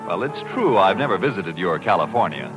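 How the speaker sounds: ordinary talk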